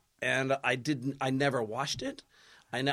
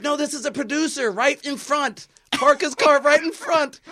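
attendant calling out; 9 semitones higher, 7 dB louder than usual. Retold or imitated. Attendant directing, retold.

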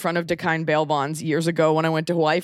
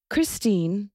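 a light touch of RP; British RP accent; 2 semitones higher than usual. Shown as a demonstration. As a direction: begin reading in Received Pronunciation. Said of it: "in a British ac-, in a R P".

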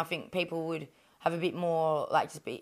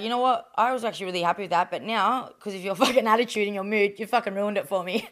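partner's voice, a bit louder, fast and huffy; mock annoyed boyfriend; 3 semitones higher, 7 dB louder than usual. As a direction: play the boyfriend's half-joking grumble, laughing through it. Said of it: "And he's like".

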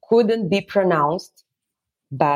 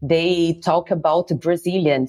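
normal talking voice

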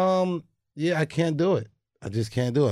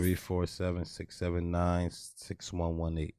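low, slow and soft, with no enthusiasm; unexcited; 8 semitones lower, 9 dB quieter, slower than usual. Real, not acted. Co-host giving listeners the voicemail number, unexcited. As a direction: read it out like you couldn't care less. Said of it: "Don't sound so excited".

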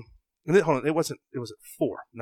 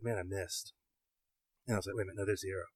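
hushed to himself; 4 semitones lower, 13 dB quieter, faster than usual. Retold or imitated. Retold thoughts while searching.

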